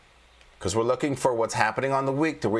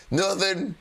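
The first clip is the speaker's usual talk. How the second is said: higher mock innocent denial; 8 semitones higher than usual. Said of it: "They're gonna be like".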